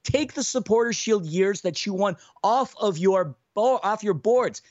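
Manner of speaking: ordinary delivery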